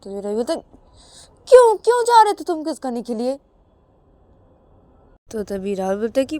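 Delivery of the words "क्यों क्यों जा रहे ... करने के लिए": Ravi demanding why. Acted.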